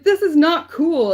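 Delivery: high, loud, slow not cool; loud aggrieved complaint; indignant protest. Retold or imitated. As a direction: scold the divine with mock outrage. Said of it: "And I would be like".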